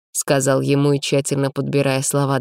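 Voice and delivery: ordinary delivery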